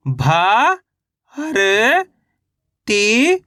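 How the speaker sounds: each syllable held long, separated by pauses. Shown as a demonstration. Teacher sounding out a word for reading learners, syllable by syllable. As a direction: sound out each letter slowly, stretching it. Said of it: "एक एक अक्षर का उच्चारण".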